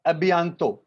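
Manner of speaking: normal talking voice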